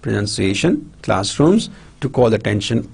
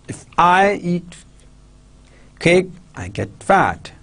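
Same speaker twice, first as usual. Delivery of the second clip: "I" lifted high, pause before "cake"; stress on I; 6 semitones higher than usual. Shown as a demonstration. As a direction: If I eat... cake, I get fat. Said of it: "this way sentence pattern is there".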